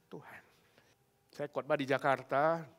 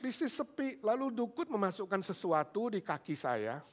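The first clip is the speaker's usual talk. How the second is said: raised, weepy retold voice; lightly acted sobbing tone; imitated tearful confession; 6 semitones higher than usual. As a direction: confess as if crying. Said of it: "dia makin nangis".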